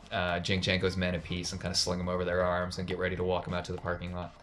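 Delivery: ordinary talk, nothing marked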